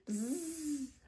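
short demonstration of a bee; soft bee drone; bee buzzing. Acted. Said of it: "Buzz like a bee".

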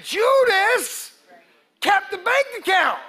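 high, loud preacher's cry; preaching shout. Real, not acted. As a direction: shout it out to the congregation.

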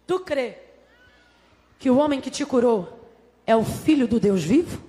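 low, deliberate, paused question; voice of Jesus. Acted. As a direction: ask solemnly as Jesus, phrase by phrase. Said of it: "olha a conversa de Jesus".

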